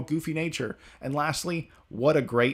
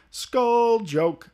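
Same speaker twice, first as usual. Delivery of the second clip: Brooke catchphrase voice; 10 semitones higher, 7 dB louder than usual. Acted.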